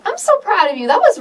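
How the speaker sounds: high, gushing praise; proud and excited. Real, not acted. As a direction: burst out with real pride. Host moved by guest's story.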